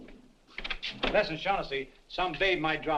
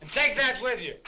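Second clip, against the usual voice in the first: higher, sharper sergeant's command; barked order; 7 semitones higher, 4 dB louder than usual. Acted. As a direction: call it out as an order. Sergeant ordering soldier about.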